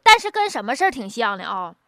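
normal talking voice

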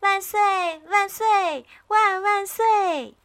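put-on high-pitched child's hurrah, last one drawn out; joyful cheering. A narrator's reading. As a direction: cheer like a delighted little boy. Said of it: "阿文高兴地说". (小男孩高兴地高声欢呼)

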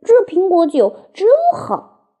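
put-on high, breathless child fox. A narrator's reading. Littlest fox after gulping cider, breathless delight. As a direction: gasp it out with delight. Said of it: "最小的狐狸气喘吁吁道".

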